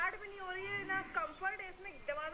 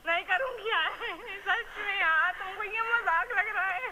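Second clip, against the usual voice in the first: louder, tearful refusal; crying; 10 dB louder, slower than usual. Real, not acted. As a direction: refuse through sobs, hurt. Crying after the prank reveal.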